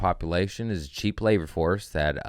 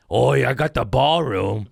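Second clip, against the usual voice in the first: mock Trump boast; 6 semitones higher, 5 dB louder than usual. Mocking Trump.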